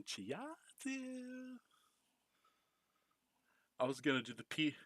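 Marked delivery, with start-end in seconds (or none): 0.0-1.6 higher sing-song calling out. Retold or imitated. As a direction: call the name out up high. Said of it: "when I was hiding behind Sora's going".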